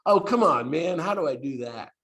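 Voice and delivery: high, protesting wisecrack to a friend; playfully retort, starting high and loud; mock-exasperated comeback; smart aleck. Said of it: "being a smart aleck".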